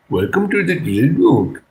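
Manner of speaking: high, swooping put-on character voice; spontaneous character impression. Shown as a demonstration. Showing chat how an impression starts, high character voice. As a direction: swing the pitch up into a character voice. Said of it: "this is how I do it".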